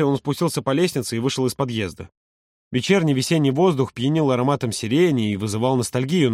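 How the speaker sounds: ordinary delivery, nothing marked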